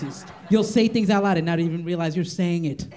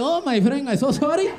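a light put-on Spanish accent, higher; fake Spanish accent; 6 semitones higher, slower than usual. Comic pretending to strangers he speaks no English, light Spanish accent. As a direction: play dumb in a mild Spanish accent. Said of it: "I'm like".

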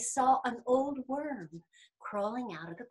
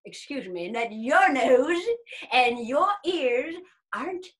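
put-on cocky old worm; 2 semitones higher, 5 dB louder than usual. Old worm challenging bear and rabbit, cocky. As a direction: put the big boasters in their place.

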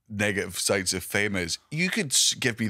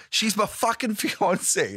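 indignant; 9 semitones higher, slower than usual. Retold or imitated. Retelling outrage at shop clerk.

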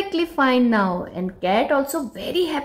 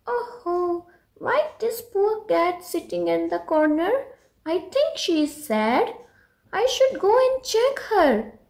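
lightly voiced little girl, higher and gently concerned; a hint of a worried girl; 7 semitones higher than usual. Pinky thinking about the sad kitten, mildly worried girl voice.

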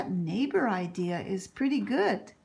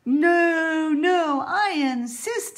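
loud, higher-pitched no, no; insisting loudly; 6 semitones higher, 8 dB louder, slower than usual. Acted. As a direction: override her refusal, eager. Girl insists on nursing patient.

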